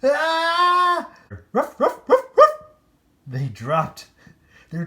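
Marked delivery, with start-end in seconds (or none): 0.0-1.1 very high, loud shriek; terrified scream. A narrator's reading. Dogs screaming at the monster, very high and loud. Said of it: "The dogs screamed for their lives". 1.5-2.6 very high, loud yelping barks; panicked barking. A narrator's reading. Scared dogs barking at the monster, very high. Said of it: "The dogs screamed".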